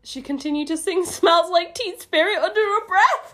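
high, loud, laughing read; reading through laughter. Real, not acted. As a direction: squeal the line out, cracking up. Reads line while cracking up.

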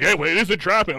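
high, loud "aha" outburst; excited group realization. Retold or imitated. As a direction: catch on all at once, amused. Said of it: "we were like".

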